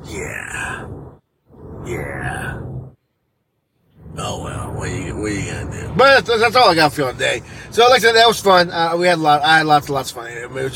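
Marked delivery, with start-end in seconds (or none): 0.0-5.9 very low, soft murmur; resigned. Real, not acted. Late driver accepting the traffic, real quiet resignation. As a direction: shrug it off quietly, voice dropped.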